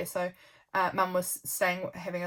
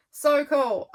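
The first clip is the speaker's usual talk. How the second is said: louder and higher, bursting with delight; excited; 7 semitones higher, 8 dB louder than usual. Real, not acted. Repeating her delight that they grew pumpkins, excitedly. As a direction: really gush over it.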